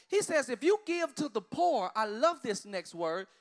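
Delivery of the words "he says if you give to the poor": preaching cry. Acted.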